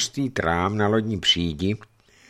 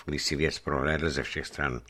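plain reading voice

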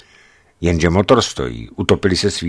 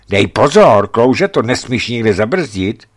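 raised, urgent warning, slowing on the last part; louder admonishing passenger; 5 semitones higher, 7 dB louder than usual. A narrator's reading. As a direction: caution the driver firmly. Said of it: "napomíná spolujezdec".

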